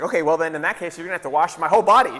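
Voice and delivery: hurried, loud, eager insistence; acted overeager. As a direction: play an overenthusiastic disciple jumping all in. Acting out eager, overdoing Peter.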